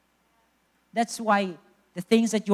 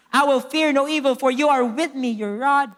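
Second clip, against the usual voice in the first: loud, high scripture declaration; declamatory preaching; 5 semitones higher, 7 dB louder than usual. Real, not acted. Preacher declares the psalm to the church, full-force preaching. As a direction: proclaim the psalm with full conviction.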